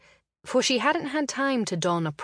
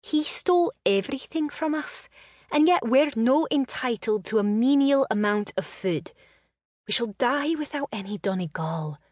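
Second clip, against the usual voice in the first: higher, bitter complaint; resentful young woman, voice raised; 6 semitones higher than usual. A narrator's reading. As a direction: let the bitterness leak through. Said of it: "Bitterness tainted her tone".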